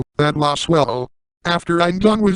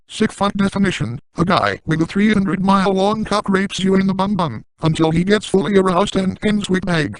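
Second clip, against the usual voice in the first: ordinary talk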